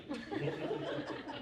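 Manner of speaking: breathy unvoiced laugh. Real, not acted. Pastor laughs about the cheeky student. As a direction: laugh at the memory.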